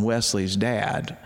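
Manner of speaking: ordinary talk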